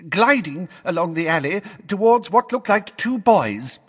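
high, loud quavery testimony; Missus Figg. Acted. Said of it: "in her quavery voice".

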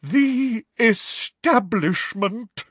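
very slow, high dramatic Trelawney; theatrical seer, voice wavering; deep, dramatic, wavering. A narrator's reading. Trelawney to Parvati, slow and wavering. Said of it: "in a deep, dramatic, wavering voice".

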